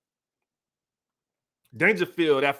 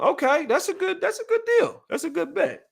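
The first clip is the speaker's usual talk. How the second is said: excited agreement; 11 semitones higher than usual. Real, not acted. Agreeing to a chat bet.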